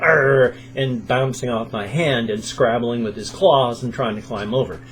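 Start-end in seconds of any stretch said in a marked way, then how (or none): 0.0-0.5 drawn-out loud arr; imitated hamster growl. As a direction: growl like a scrappy little hamster. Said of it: "this side was just going".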